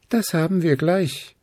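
a sudden bright idea; Luzi. A narrator's reading. Luzi chimes in with a plan, high. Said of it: "fiel Luzi ein".

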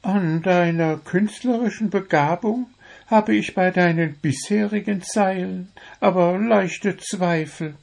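high-pitched, self-assured bird voice; proud little bird. A narrator's reading. Born romantic bird advises the doubtful poet, proud. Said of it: "sagte Zwitschi stolz".

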